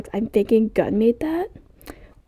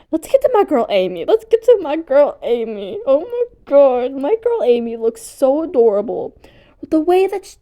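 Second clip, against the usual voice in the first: higher, fangirling delight; excited gushing; 4 semitones higher, 4 dB louder than usual. Real, not acted. Vlogger moving on to favorite character Amy, excited gushing. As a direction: gush over your favorite, pitch lifted.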